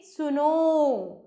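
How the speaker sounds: सुनो stretched very long, as if calling someone; prolonged calling vowel on सुनो; drawn-out call. Shown as a demonstration. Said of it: "इसका प्रयोग अक्सर पुकारते समय".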